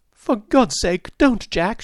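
high, slow, pleading cry; desperate plea. A narrator's reading. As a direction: beg him to stop, voice straining high. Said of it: "she cried".

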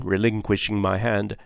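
ordinary delivery, nothing marked

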